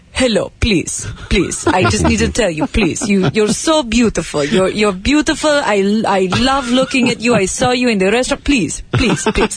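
put-on pleading Persian accent; imploring suitor with a Persian accent; Persian man impression. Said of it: "do you want me to do the exact impression".

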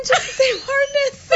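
high, slow words dissolving into laughter; laughing. Real, not acted. Telling cohost he's about to laugh again, giggling. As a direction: fight off the giggles.

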